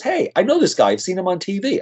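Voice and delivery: lightly imitating a guy thrilled to recognize him; excited recognition.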